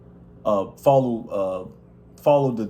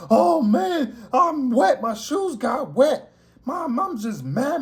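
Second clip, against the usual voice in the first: high-pitched, drawn-out mock yell; mock a guy yelling his complaints, pitched way up; imitated yelling; 9 semitones higher, much slower than usual.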